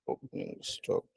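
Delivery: near-voiceless murmur, very soft; barely audible self-talk; muttering to oneself. Real, not acted. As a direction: whisper it privately while reading. Reading to himself while working.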